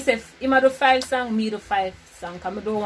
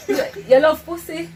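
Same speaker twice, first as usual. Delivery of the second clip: laughing; 7 semitones higher, 9 dB louder than usual. Real, not acted. Headphones on, laughing guess.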